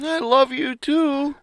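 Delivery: high silly falsetto voice; hammed-up falsetto husband; mock husband falsetto. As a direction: squeak his own part like a cartoon. Mock phone call with wife.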